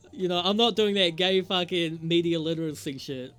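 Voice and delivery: laughing. Real, not acted. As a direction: laugh off the analysis. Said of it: "that's not what this channel is for".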